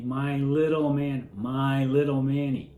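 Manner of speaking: slow, stretched-out croon; mother cooing to baby. Retold or imitated. Woman saying it to her baby boy, slow crooning. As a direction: draw each word out as if soothing an infant. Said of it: "who's saying to this baby".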